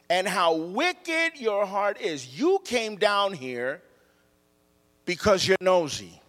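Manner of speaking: slow, drawn-out scornful accusation; accusing, talking down. Acted. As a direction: talk down to him as an accusing big brother. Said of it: "He talks down to David, and he accuses David".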